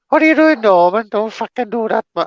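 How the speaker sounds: high, loud mock telling-off; high-pitched exasperated cartoon mum; scolding cartoon mother. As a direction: scold a naughty kid in a high voice. Acting Fireman Sam mother scolding Norman.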